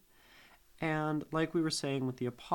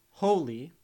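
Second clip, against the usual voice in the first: "holy" with the stress on HO, first syllable strong; trochee stress; 4 semitones higher, 8 dB louder than usual. Shown as a demonstration. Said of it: "a word that goes".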